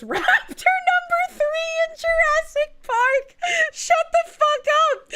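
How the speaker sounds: high shouted disbelief; disbelieving shout. Real, not acted. Shouting at chat over a wild fun fact. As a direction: yell it in shocked delight.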